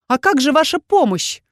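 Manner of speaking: shouted after him. A narrator's reading. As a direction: shout it at his back. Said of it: "выкрикнула я ему вслед".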